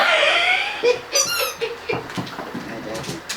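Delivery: long squealing laugh, high up. Real, not acted. Grandma laughing at the dog, high squealing laugh. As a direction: let a high giggle run out.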